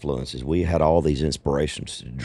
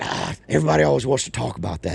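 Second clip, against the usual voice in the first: higher-pitched mock complaint; retold annoyance; 7 semitones higher, faster than usual. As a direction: imitate someone fed up with the question. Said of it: "And he hated it".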